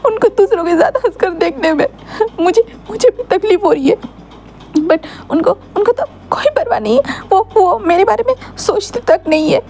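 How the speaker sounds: crying Wing. Acted. Wing weeping to Lancer about his pain, sobbing. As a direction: ask for help while weeping. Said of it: "इतने ज़्यादा मत रो wing".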